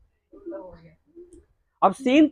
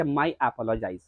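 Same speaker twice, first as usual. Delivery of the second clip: much lower and softer; meek apology; 11 semitones lower, 10 dB quieter, much slower than usual. Acted. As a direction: hush it down to a sheepish apology. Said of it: "Natalia कहती है".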